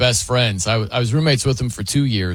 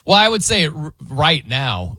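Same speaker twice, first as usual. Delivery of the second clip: ordinary talk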